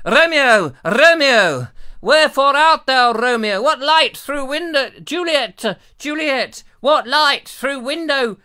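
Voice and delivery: loud, high hammed-up declamation; Shakespeare parody. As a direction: call out grandly as a bad stage Juliet. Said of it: "all the Shakespeare stuff".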